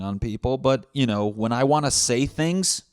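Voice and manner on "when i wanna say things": usual speaking voice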